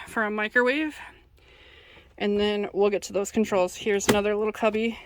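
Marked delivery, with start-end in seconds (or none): none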